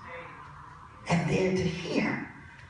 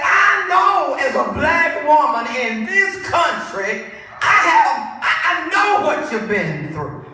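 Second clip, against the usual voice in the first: loud, raised preacher's quote; preached retelling; 9 semitones higher, 9 dB louder than usual. Retold or imitated. Preacher retells words of support to a woman, loud preaching. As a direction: deliver the quote in full preaching voice.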